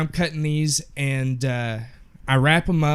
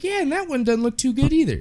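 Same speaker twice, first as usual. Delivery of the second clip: higher mock customer voice, mildly whiny; picky customer; 11 semitones higher than usual. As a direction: play the fussy customer lightly, pitch up.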